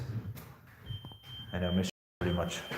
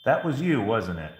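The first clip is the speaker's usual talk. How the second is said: startled; 5 semitones higher, 6 dB louder than usual. Real, not acted. Startled by device going off.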